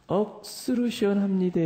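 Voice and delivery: high-pitched Gyeongsang accent; high, emphatic Gyeongsang dialect. Shown as a demonstration. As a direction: stretch "억수로" in Gyeongsang style. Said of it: "경상도 말로 뭐라 그래요?".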